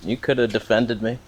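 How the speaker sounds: ordinary talk, nothing marked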